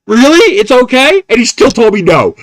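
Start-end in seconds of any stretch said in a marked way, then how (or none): 0.1-1.2 high, loud stunned question; can't believe what he's hearing; stunned disbelief. Retold or imitated. Museum guard hears the captain approve the drone flight, stunned disbelief.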